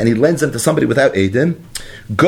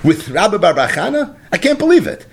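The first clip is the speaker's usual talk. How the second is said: incredulous question; 5 semitones higher than usual. Acted. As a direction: voice the Gemara's astonished challenge. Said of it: "the Gemara asks a kasha".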